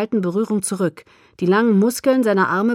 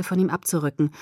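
ordinary delivery

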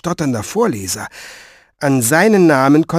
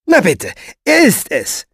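high, triumphant scorn; scornful "told you so", pitched up; contemptuous announcement; 7 semitones higher, 3 dB louder than usual. A narrator's reading. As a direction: announce it with open disdain. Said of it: "verkündete sie und musterte ihn mit solcher Verachtung".

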